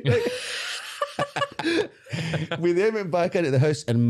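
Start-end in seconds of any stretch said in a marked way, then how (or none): 0.3-1.9 real, very high cackle of laughter; high-pitched laugh. Real, not acted. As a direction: crack up at your own punchline, high. Cracks up at own punchline.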